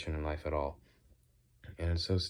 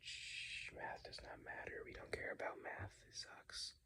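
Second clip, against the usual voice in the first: hushed dismissive whisper; shush, then whisper very softly; 21 dB quieter than usual. Pretend. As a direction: whisper it like a secret aside. Aside dismissing math in whisper.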